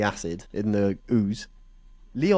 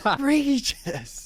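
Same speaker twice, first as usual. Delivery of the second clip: high, drawn-out exclamation; theatrical squeal of disbelief; mock outrage; much slower than usual. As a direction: stretch it out in high-pitched mock indignation.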